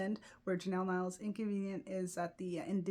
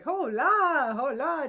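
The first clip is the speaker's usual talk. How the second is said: high, loud mock-snooty hola; snooty greeting; 7 semitones higher, 9 dB louder, slower than usual. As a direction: toss the hola off like a cliquey snob. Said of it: "just ever snooty".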